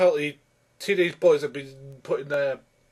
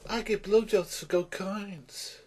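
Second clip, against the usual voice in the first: slower, higher, softer mock fairy; 4 semitones higher, 7 dB quieter, slower than usual. Reviewer quoting the film's fairy, high soft fairy voice. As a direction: play a little fairy, pitched up and slow. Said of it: "it's this fairy says".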